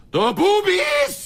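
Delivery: high, slow leering yell; excited shout. Acted. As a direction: bellow it with glee. Leering outburst about breasts.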